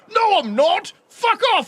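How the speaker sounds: high, loud yell of defiance; mock defiant yell. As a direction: shout back at the cops, hammed up. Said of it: "and you go".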